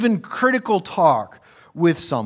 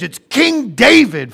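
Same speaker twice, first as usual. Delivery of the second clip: usual speaking voice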